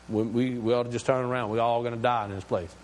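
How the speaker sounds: fast, rushed complaint; hurried, mildly fearful grumbling; panicked complaining. Acted. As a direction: gabble it out in a panic. Panicked Israelites want to turn back.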